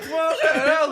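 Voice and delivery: high, loud, laughing outcry; comic shocked exclamation through laughter; mock disbelief. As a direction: play a baffled patient, hammed-up and high. Acts out shocked patient in bed.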